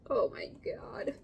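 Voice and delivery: slow, whispered amazement; whispered awe. Real, not acted. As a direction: breathe it out in awe. Looking at stuffed sandwich.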